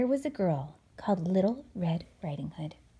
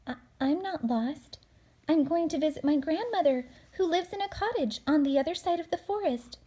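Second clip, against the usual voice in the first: put-on higher, bright girl voice; little girl voice; 7 semitones higher, 3 dB louder than usual. Girl answers the wolf in a higher girl's voice.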